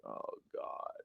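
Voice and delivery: whispered groan of dread. Retold or imitated. Fox News staff seeing Trump show up, dreading whisper. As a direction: breathe it out unvoiced, dreading the call. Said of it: "they see him show up and they're like".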